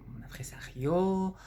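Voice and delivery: whispered aside. Acted. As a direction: drop to a whisper mid-sentence.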